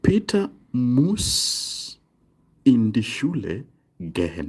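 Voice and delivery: drawn-out Peter muss, split into phrases; slow model sentence. Shown as a demonstration. Teacher giving a German example to the class, slow modelling. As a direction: say it slowly for learners, pausing between phrases.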